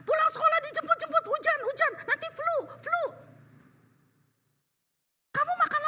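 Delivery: high, fast, fretting mother voice; mock a fussing mother who frets over every step; fussy overprotective mother. Preacher mocks an overprotective mother fussing at her child. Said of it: "Kamu lindungin anak kamu supaya nggak kepanasan nih".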